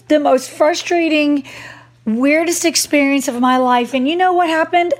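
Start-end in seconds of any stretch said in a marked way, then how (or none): none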